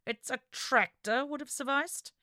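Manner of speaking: clenched-teeth restrained irritation; gritted teeth. Acted. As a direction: bite the words out tightly. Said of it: "through gritted teeth".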